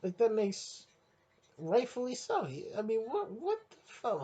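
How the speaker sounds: very high squeaky cry of disbelief; outraged disbelief. Acted. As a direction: squeal it like you can't believe it. Outraged rant about bad wrestling.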